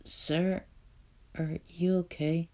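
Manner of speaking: put-on high, soft timid child; small kid voice. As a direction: ask it like a scared little boy. The little kid speaks.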